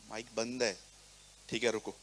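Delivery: low, hushed cues to the mic crew; half-voiced stage signals; signalling quietly. Pastor mimes cues to the mic crew, hushed. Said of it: "इन दोनों को मैं इशारे कर रहा हूँ".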